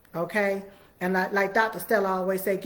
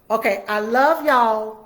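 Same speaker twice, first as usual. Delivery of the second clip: loud slow goodbye; 3 semitones higher, 8 dB louder than usual. Real, not acted. Signing off warmly to viewers.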